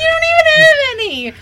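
high, loud laughing squeal; shrieking laugh. Real, not acted.

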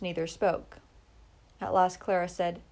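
ordinary delivery

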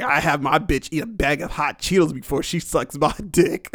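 ordinary delivery

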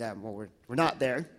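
ordinary talk, nothing marked

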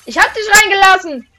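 loud, high-pitched protest; outraged denial at high volume; indignant shout. Real, not acted. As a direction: shout back in your own defence. Defends himself against the accusation.

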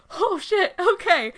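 high, taken-aback mock reply; startled compliance. Podcaster retelling their answer to Morgan's orders, startled and compliant. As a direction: jump up in pitch, acting out being told off.

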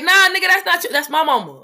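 high, loud mock-indignant outburst; possessive protest. Telling others that's my mama, loudly possessive. Jealous child claiming their mother.

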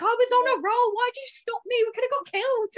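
high, loud mock complaint; imitated whining protest. Teasing her friend over the umbrella attack, imitated protest. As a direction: mimic your friend protesting. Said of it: "And you were there like".

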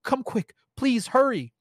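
high, pleading urgency; frightened woman begging her boyfriend to come; frantic begging. A narrator's reading. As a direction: beg him to hurry, scared.

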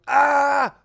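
high, loud "ah"; mock pain scream. Hiker as the boulder lands on the leg, acted scream. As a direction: yell out in pretend pain.

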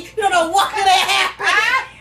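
put-on drunken scolding. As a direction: scold her loudly while laughing. Drunk friend scolds her.

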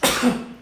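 sudden loud sneeze. Real, not acted. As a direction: let out a sharp sneeze. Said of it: "desculpa, eu vou espirrar".

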